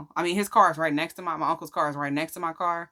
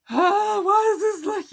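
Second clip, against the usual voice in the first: high, wailing, hammed-up sob voice; exaggerated crying lament; mock boohoo crying; 4 dB louder than usual. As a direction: wail it like a melodramatic crier. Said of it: "I'm boohoo crying".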